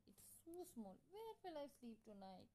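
high-pitched helpless whine; acted plaintive fretting; whiny complaint. A narrator's reading. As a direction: whine as the fussy bride. Bride complains about the mouse hole.

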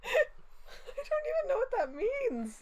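high, slow, helpless laughter through the words; laughing hard. Real, not acted. Friend baffled by the jealous denial line, laughing. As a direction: squeal it out while cracking up.

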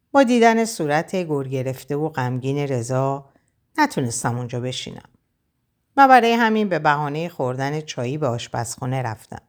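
usual speaking voice

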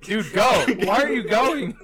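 high, loud pleading through amusement; comically desperate, imitated hollering; frantic mock shout. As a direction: yell like a stranded getaway passenger. Acting panicked prankster urging driver.

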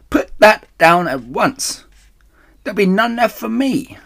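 very high, indignant rat voice; shrieking Rat. A narrator's reading. Rat guarding his cider from the others, indignant shriek. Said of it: "shrieked Rat".

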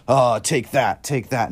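loud, jabbing take that; hammed-up vengeful God; mock spiteful taunt. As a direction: hurl it like throwing someone away. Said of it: "chucking people into heaven or into hell".

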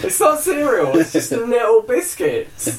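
high, slow put-on complaint; mock indignant voice. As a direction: sound like a fussy child whining about biscuits. Mocking cereal, comic voice.

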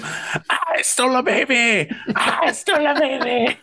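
very high, drawn-out tiny brownie cry; hammed-up squeaky brownie boast; brownie yelling. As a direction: crow it slowly in a tiny creature's voice. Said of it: "You just hear them".

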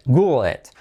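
normal talking voice

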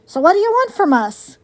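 gruffly challenging, only lightly growled; growling fox. A narrator's reading. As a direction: demand it with a touch of growl. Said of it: "growled Fink".

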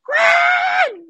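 very high, loud, long shout of Juan; shouting a name. Retold or imitated. Niurka losing patience, yelling at Juan across the house. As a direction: yell his name when he won't turn. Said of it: "y le dice".

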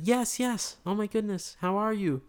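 high, effusive retold greeting; flustered greeting. Burger guy greeting the returning widower, flustered and apologetic. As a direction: gush with concern, pitched well up.

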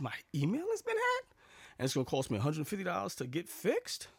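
high-pitched disbelief, rising on the question; incredulous questioning. Retold or imitated. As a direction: echo the claim as if it's absurd. Questioning the scammer's claim in disbelief.

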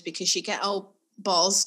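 ordinary delivery

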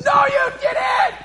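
high, strained, drawn-out scream; gamer's yell. Retold or imitated. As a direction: imitate a frantic gamer screaming at the screen. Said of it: "it sounds a bit like this".

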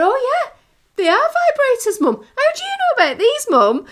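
high, loud teasing son; high mock son teasing his mum; son realising. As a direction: pitch it high and accusing. Son's realisation, retold.

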